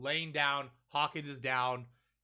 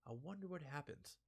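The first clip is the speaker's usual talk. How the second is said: Killer's menacing wonder; 18 dB quieter than usual. Retold or imitated. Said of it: "He just stares like".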